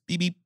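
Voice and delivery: high, quick cartoon honk; Roadrunner beep-beep. Host saying his Roadrunner days are gone, high cartoon beeping.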